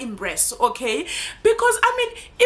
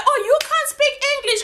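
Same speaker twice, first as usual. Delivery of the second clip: mocking critic; 11 semitones higher than usual. Mocking critics of her English.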